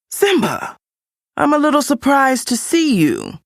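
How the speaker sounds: high, mocking, drawn-up voice; sneering Scar. Scar mocking his nephew Simba, high and sneering. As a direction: purr out the fake surprise.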